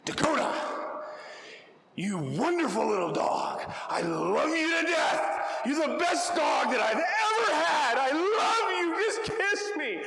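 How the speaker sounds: high, loud furious shout with loving words; mad-sounding shouting at the dog Dakota; angry tone. Shown as a demonstration. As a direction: yell sweet words like you're furious. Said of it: "my tone says, "Man, I'm, I'm mad at you".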